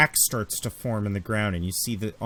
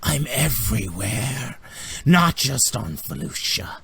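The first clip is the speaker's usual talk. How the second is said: wicked villainess. Acted. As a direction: stretch the words out like a gloating villainess. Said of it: "She goes".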